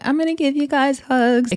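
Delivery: sugary high-pitched offer of hugs; syrupy, sing-song and high; sweet high voice. Retold or imitated. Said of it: "L C K is like".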